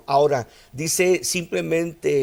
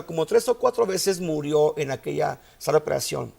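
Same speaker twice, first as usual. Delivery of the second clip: normal talking voice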